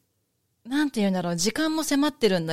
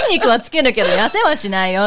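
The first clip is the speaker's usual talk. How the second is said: emphatic quoted retort; 9 dB louder than usual. Retold or imitated. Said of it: "って".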